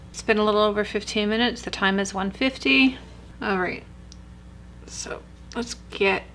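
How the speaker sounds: lips framed by mask; careful limited mouth movement; sheet mask on face. Real, not acted. Seen 1: Wet sheet mask covers face around mouth throughout labelled speech.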